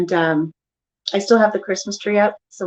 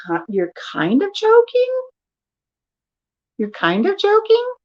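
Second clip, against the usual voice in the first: high, challenging question; acted disbelief, pitched way up; mock incredulity; 12 semitones higher than usual. Wife challenging husband's excuse, incredulous.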